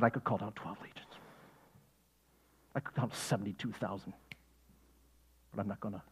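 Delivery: "I could call down twelve legions" very soft and low; hushed restraint. Acted. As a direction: hold all that power back in a near whisper. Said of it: "He said".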